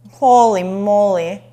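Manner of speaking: loud and drawn out with delight; slow, wowed by the juice; excited exclamation. Real, not acted. Cook marveling at juicy chicken for viewers, loud slow awe.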